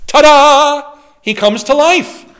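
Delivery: big high loud ta-da; excited reveal. Acted. Preacher to congregation, revealing the dead man rising, with a loud showman's ta-da. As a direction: announce the miracle like a magic trick.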